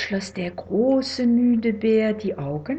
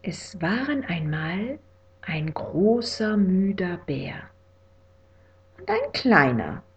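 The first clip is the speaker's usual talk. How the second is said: whispered bedtime story; 4 dB quieter than usual. A narrator's reading.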